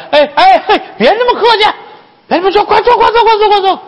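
very high, fussing chatter; flustered, over-eager madam; fawning welcome. Acted. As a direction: usher her in with gushing eagerness.

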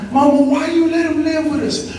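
retold crying plea. Child asks mama about the boyfriend, in a retold crying plea. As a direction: mimic a child's tearful plea, pitch lifted. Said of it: "I be bawling, crying".

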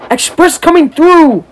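loud, slow call. Acted. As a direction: boom it down the line. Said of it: "said Gordon".

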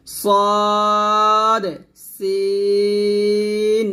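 সদ thick, সিন thin, both drawn out; hissing letters. Shown as a demonstration. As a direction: let সদ and সিন hiss, stretched. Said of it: "সদ মোটা হবে, সিন পাতলা হবে". (সদ মোটা, সিন পাতলা করে)

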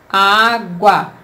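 "Água" as á, gua, short break between; measured two-part "Água" for pupils; slow, split syllables. Shown as a demonstration. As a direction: separate both syllables of "Água" clearly. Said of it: "Fala devagar aí a palavra".

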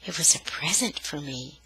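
soft, whispered delight; breathless with pleasure. Acted. Sister admitting the gift to her brother, breathless whisper. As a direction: breathe it out, thrilled. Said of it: "breathless with pleasure".

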